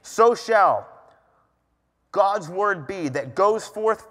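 slow, weighty scripture reading; deliberate, emphatic preaching read; personalized scripture declaration. Acted. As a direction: proclaim it as if your own name were written in it. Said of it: "I took that as if my name was in that".

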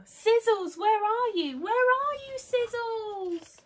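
high, loud, drawn-out search call. A narrator's reading. Children search for their missing dog, calling out anxiously. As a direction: call across a park for a lost dog.

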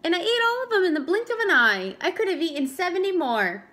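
quick, loud excited boast; fast bragging. Acted. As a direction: rush out the brag. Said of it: "said Franklin".

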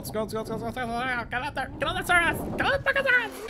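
high, rising desperate urging; frantic urging. Real, not acted. Gamer frantically urging his drowning character upward. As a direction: push the swimmer up in a panic. Frantic scrolling to escape water.

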